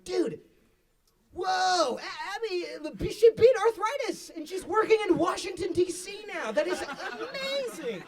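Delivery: laughing overexcited praise; gushing excitement. Retold or imitated. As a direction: rave about her like a miracle. Gushing about Abby to friend.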